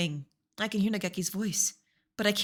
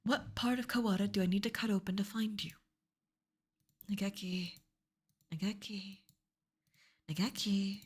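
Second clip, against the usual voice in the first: whisper; 6 dB quieter than usual. Acted. Calling to the lost friend.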